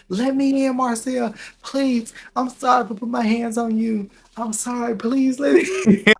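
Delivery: high, loud pleading voice; mock him whining at the door; begging ex.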